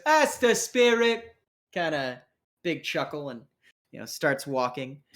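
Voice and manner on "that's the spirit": high, drawn-out hearty cheer; hearty crew member. Acted. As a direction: cheer on a grim comrade, slow and high. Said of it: "Um, he goes".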